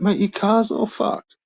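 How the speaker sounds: high, slow, stunned cop; incredulous cop. Retold or imitated. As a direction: spell out the obvious to the drunk, amazed. Cop points out the wrecked car.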